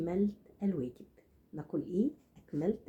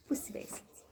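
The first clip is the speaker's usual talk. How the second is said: whisper; 6 dB quieter, faster than usual. Acted. Confiding aside to viewer.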